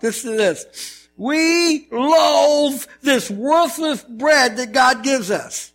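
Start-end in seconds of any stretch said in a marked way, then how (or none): 1.2-5.7 slow, drawn-out contemptuous tone; mock disgust of the Israelites at the manna; disdainful sneer. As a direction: sneer at the bread slowly. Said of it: "ticked God off".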